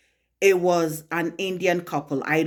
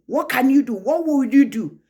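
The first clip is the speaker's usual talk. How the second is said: challenging husband; 7 semitones higher, 5 dB louder than usual. Retold or imitated.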